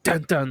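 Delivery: "dun, dun" as two short beats; rhythm demo. Shown as a demonstration. Player shows a foot-stamp signal, "dun, dun" in two beats. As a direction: tap out "dun, dun" as a double signal. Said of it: "just, like, some sort of".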